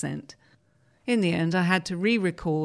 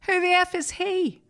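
imitating an outraged director, much higher and a bit louder; retold indignant outburst, pitched way up; mock outraged director; 13 semitones higher, 4 dB louder than usual. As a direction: squeak it out in indignant disbelief as the director.

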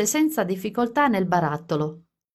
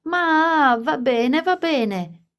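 put-on child shrieking, higher and louder, drawn out; 8 semitones higher, 3 dB louder, much slower than usual. A narrator's reading. Edward squealing as his mother pinches his cheeks. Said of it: "finché Edward non strillò".

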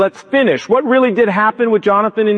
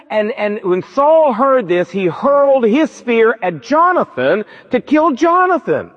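ordinary talk

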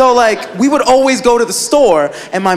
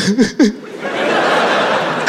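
breathy mock sobs; mock crying; 3 dB quieter than usual. Brother seeing the ruined cereal, mock sobbing. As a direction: imitate a child's stuttering sobs without words. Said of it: "Like, crying".

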